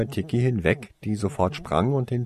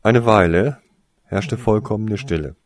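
usual speaking voice

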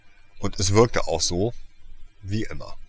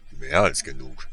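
Hagrid's voice; 6 semitones lower than usual. A narrator's reading.

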